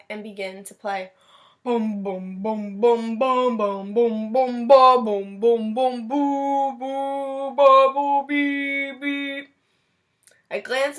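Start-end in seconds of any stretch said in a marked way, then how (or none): 1.6-6.1 voicing a tuba, booming each bum; mock tuba bum bum. Kid reading aloud, mimics his tuba, loud bum bums. 6.1-9.5 mock tuba notes.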